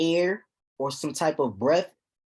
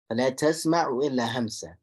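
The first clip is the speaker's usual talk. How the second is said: quick, flowing recited verse; quick tajweed recitation; Quran recitation; 3 semitones lower, much faster than usual. Shown as a demonstration. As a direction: chant the ayah fast in one breath.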